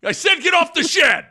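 Will Ferrell shed yell impression; mock yell. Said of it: "the whole sketch where he's like".

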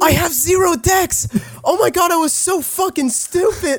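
excited shout. Real, not acted. Realizing his own blunder.

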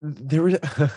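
choked up, trying to hold it together; getting emotional. Real, not acted. Guest on a podcast recalling a screening, getting emotional. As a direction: voice lifting, fighting back tears with a nervous laugh. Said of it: "I'm gonna get emotional again".

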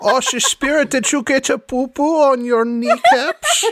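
put-on high, theatrical accented baby talk; Sans Serif, cooing. Teasing the spirit about his kneecaps, high cooing voice. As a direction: coo it mockingly with slushy s sounds.

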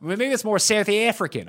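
lightly put-on South African accent; playful attempt at South African. Shown as a demonstration. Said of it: "I can do the South African pretty good".

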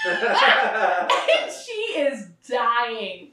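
words squeezed out through laughter; can barely get it out for laughing; slow giggling delivery. Real, not acted. Laughing while telling.

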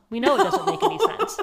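high, loud, laughing through the words; laughing retelling. Retold or imitated. Host quoting cops to the suspect, breaking up laughing. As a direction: crack up while quoting the cops.

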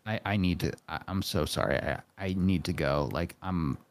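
low, subdued retold plea; soft, low imitation of feeling sick; imitating queasy apology. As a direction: quietly act out needing to leave. Retells sick wife's urgent plea.

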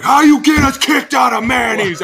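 slow, loud, high yell; angry shout. Retold or imitated. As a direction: shout in disbelief. Angry GM on phone.

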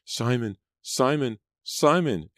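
high, rising piercing shriek. Acted. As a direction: shriek his name in terror. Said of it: "Angelica shrieked behind him".

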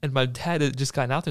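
ordinary delivery

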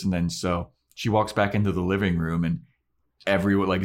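usual speaking voice

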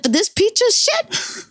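high, disbelieving jab at the junk car; incredulous scoff. Retold or imitated. Haggling with her crackhead friend, incredulous high scoff. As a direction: sneer at the price like it's a joke. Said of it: "I said".

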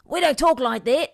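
put-on Australian girl's accent. Australian girl objecting to the imitation, loud Australian accent. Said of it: "an Australian girl".

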